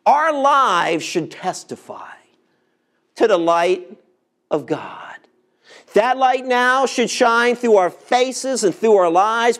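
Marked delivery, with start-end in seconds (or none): 1.0-2.1 whisper. Acted. Preacher drops to hushed emphasis. 4.5-5.2 whisper. Acted. Preacher hushes the phrase end.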